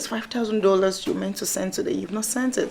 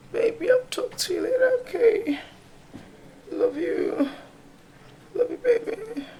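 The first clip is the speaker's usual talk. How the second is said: tearful goodbye; 7 semitones higher than usual. Pretend. Sick caller's tearful goodbye.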